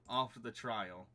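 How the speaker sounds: usual speaking voice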